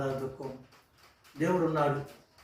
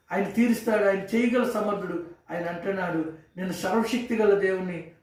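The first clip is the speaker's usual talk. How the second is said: loud, higher, fervent preaching; impassioned pulpit preaching, louder and higher; preaching at full force; 3 semitones higher, 3 dB louder than usual. Acted. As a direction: build it into a rousing call to trust God. Sermon rises to full preaching.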